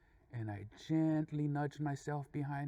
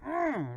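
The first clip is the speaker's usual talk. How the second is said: high, tight "mm"; mean-mugging grunt; 10 semitones higher, 4 dB louder than usual. Retold or imitated. Two drivers mean-mug each other, mean-mugging grunt. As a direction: hum a short, high, tough-guy grunt. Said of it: "we mean-mugged each other like".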